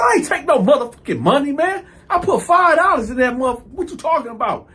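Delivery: high-pitched, heated protest; indignant denial. Retold or imitated. Retold denial of theft accusation.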